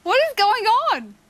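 "What is going on" spelled out, high rise then fall; baffled emphasis. Real, not acted. Repeats question into baffling silence.

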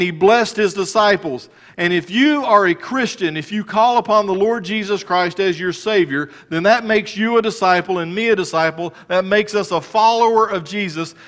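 full-force sermon delivery; fervent preaching. Acted. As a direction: preach it out to the congregation.

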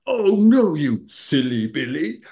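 comically restrained road rage; mock exasperation. Retelling his reaction to being cut up.

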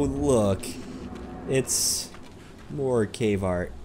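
ordinary talk

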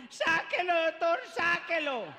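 high, drawn-out pleading cry; desperate mother in labor. Retold or imitated. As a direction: beg the doctor in agony.

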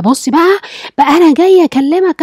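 harsh retort. Retold or imitated. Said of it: "رد الفعل العنيف ده".

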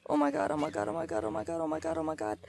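frantic repeated oh my god; chanting in real fright; panicking gamer. Real, not acted. Animal attacking in game.

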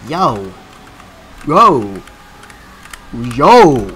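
escalating loud exclamations; mounting alarm. Real, not acted. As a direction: call out in growing alarm.

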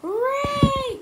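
put-on long high cheer from a crowd of kids. A narrator's reading. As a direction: cheer loud and high like happy children. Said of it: "cried the children".

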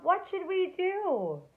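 high, loud, slow worried cry; cried out. A narrator's reading. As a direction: call out in alarm. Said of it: "The children cried out".

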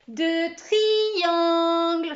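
réponse aiguë, forte, très étirée; classe en chœur. Acted. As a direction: scande comme toute une classe. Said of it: "répondit la classe d'une seule voix". (réponse de classe, traînante et criée)

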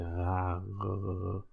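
raar drawn out with throaty uvular R; French-ish R. Shown as a demonstration. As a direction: stretch raar with a guttural French R. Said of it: "kind of French-ish".

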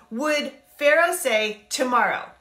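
ordinary talk

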